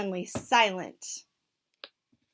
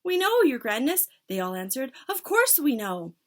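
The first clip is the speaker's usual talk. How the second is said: high, loud cringing chorus; cowed witch chorus; 5 semitones higher, 6 dB louder than usual. Acted. Witches answering their leader fearfully, high.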